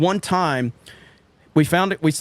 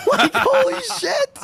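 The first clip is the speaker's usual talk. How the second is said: very high, drawn-out squeal; slow falsetto comic astonishment; amazed laughter; slower than usual. Retold or imitated.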